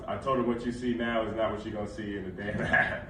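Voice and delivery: laughing. Real, not acted. Laughing while retelling his words.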